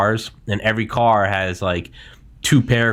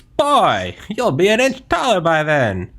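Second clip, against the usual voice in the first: put-on old-timey voice; 7 semitones higher than usual. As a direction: sell it like a wartime newsreel. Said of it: "Old-timey voice".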